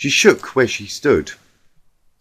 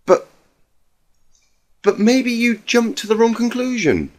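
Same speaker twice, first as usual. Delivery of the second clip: shocked disbelief; 9 semitones higher than usual. Acted. Twilight shocked, high.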